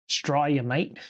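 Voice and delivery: hammed-up Aussie accent on "Australia, mate"; badly faked Australian twang; awful Australian accent. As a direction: put on a jokey, terrible Australian accent. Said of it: "That was really an awful, awful, um, a-, accent".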